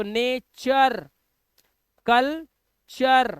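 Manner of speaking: नेचर and कल्चर drawn out, ending in -cher; very slow, careful नेचर, कल्चर; teacher models नेचर, कल्चर slowly; slow model reading. Shown as a demonstration. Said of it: "यू R E आए तो उसे अर पढ़ना है".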